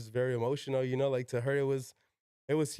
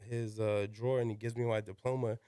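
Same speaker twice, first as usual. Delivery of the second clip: ordinary talk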